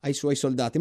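plain reading voice

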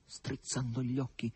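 ordinary delivery, nothing marked

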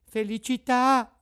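Pinocchio's voice. A narrator's reading. Puppet blesses the sneeze.

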